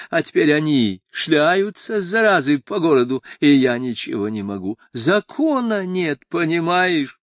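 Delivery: high, tipsy, aggrieved appeal; drunken police chief, pleading. A narrator's reading. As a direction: plead like a drunk official at his wits' end.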